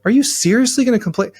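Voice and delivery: high-pitched exasperated nagging; exasperated complaint. Retold or imitated. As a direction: play the partner who is sick of being nagged. Acted defensive partner snapping back.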